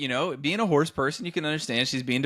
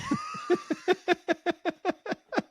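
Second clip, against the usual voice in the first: high giggle. Real, not acted. Laughing at own punchline.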